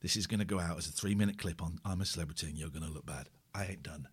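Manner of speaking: hushed inner monologue; inner thoughts. Retold or imitated. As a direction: murmur it as a private thought. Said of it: "I'm thinking".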